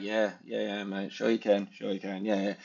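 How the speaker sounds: softly humouring a boaster; mock dismissive. Voices a sceptical public's reply.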